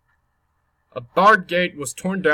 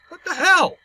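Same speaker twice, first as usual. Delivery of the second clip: startled shout; 12 semitones higher than usual. Real, not acted. Startled by game event.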